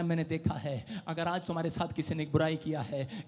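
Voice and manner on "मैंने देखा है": soft, low, falling; quiet voice of God. Acted. Preacher repeats the Spirit's words to the crowd, hushed. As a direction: hush it like a solemn vow. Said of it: "कह रहा".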